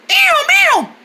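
high repeated siren wail; shrieking bird distress call, like a fire alarm; alarm-like bird cry. Acted. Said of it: "It sounded like a fire alarm".

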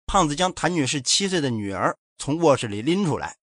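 plain reading voice

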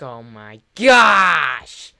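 long drawn-out exclamation swelling loud; hammed-up horror, stretched out; shocked outburst. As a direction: build slowly to a big shout on "gosh". Acted shock at the sight.

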